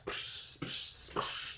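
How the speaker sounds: series of sharp "psh" crash noises; imitated glass smash. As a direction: mimic glass shattering, psh after psh.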